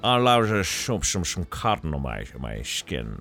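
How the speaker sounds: slushy mock Scottish, s turned to sh; terrible Connery accent. Trying again at the prisoner's wishes, terrible Connery accent. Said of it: "That's a terrible accent".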